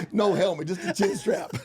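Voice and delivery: high, loud, tickled delivery; laughing punchline. Real, not acted. Player revealing the gag gift for Blauser, cracking up. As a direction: crow it high between bursts of laughter.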